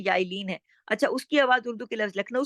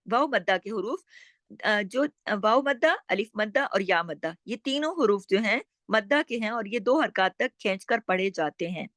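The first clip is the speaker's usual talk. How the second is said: usual speaking voice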